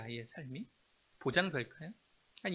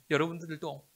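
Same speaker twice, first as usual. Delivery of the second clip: ordinary delivery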